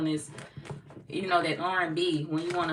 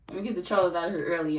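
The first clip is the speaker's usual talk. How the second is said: ordinary talk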